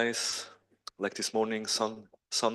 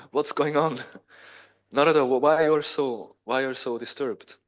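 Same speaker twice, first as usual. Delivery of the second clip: higher, louder, stumbling puzzlement; baffled questioning; 3 semitones higher, 5 dB louder than usual. Acted. Krishna to suddenly crying Narada, baffled. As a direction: ask in amused, acted bewilderment.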